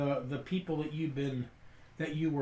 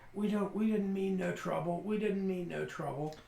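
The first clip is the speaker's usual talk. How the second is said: higher, pleading rustic voice; apologetic older farmer; 5 semitones higher, 4 dB quieter than usual. Acted. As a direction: plead innocence as a cornered old farmer. Game master voices Brady.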